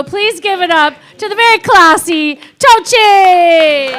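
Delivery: loud, drawn-out stage intro shout; announcer's introduction. Real, not acted. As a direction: build to a big shouted name. Host brings on next comedian.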